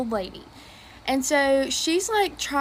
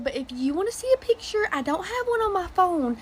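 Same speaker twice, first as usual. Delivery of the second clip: sweet granny; 5 semitones higher than usual. Retold or imitated. Old lady offering picture.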